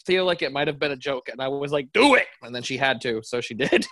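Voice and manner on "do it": mock shout, high and loud; punchy, high-pitched egging on; eager command. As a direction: burst out with a playful order. Quoting their own eager demand.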